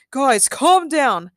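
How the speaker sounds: slow, loud plea for calm; hollering. A narrator's reading. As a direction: holler it slowly over the others. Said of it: "Ben hollered".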